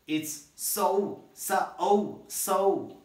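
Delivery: so with a gliding OU. Shown as a demonstration. Teacher drilling so with a gliding OU. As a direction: stretch so from O to U. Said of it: "Don't say so".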